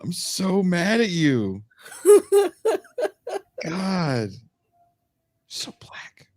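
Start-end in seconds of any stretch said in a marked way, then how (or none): 5.5-6.3 breathy whispered aside. Real, not acted. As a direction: whisper it under your breath.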